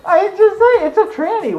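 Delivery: laughing. Real, not acted. Joking, laughing through the line.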